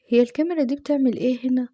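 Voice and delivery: usual speaking voice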